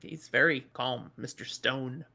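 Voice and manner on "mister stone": ordinary delivery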